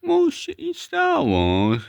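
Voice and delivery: squeaky high voice, slow and drawn; very high slow squeak. Acted. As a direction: complain like a tiny squeaky toy.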